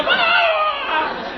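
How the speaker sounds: high-pitched fan shriek, imitated; imitated girl scream. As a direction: squeal like a teenage fan. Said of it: "This girl go".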